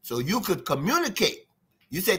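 ordinary delivery

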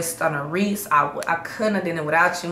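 ordinary delivery

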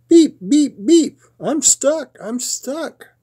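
high-pitched little truck voice in distress; crying little truck. A narrator's reading. Stuck truck calls the animals, high distressed cry. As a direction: squeak out the alarm, fading at the end. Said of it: "cried the Little Blue Truck".

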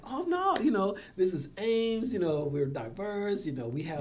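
high, loud opening, settling as it goes; dismayed protest. Retold or imitated. Retells own dismayed protest to himself.

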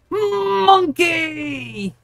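drawn-out, high-pitched playful squeal; silly high cry. Pretend. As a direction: hold the word high and long, goofily. Playful cry after joking about monkeys.

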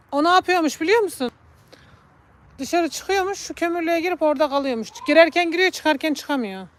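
high, loud urging calls; coaxing the cat. Real, not acted. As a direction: coax the cat out of the coal shed. (kediyi dışarı çağırırken yüksek sesle)

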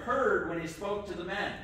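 usual speaking voice

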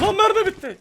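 high, loud panic; panicked shout. Real, not acted. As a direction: yelp it in alarm. Runs out of ammo mid-fight.

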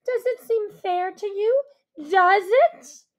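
fairy queen screeching. Acted. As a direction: demand an answer at full pitch. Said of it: "Her voice rose to a screech".

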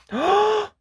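put-on a big high gasp of surprise for the children; storyteller gasp. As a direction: gasp as if you've spotted someone sneaking in. Reading aloud, spots a new character.

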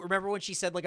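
ordinary talk, nothing marked

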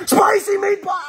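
big joyful yell; excited shout. Real, not acted. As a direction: belt it out with glee. Shouting the snake's nickname.

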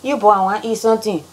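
fast Liberian English; quick Liberian come-on; Liberian accent. Retold or imitated. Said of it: "Girls say the same thing".

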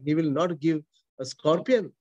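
ordinary talk, nothing marked

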